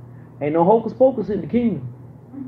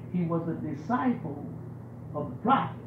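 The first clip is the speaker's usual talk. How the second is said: usual speaking voice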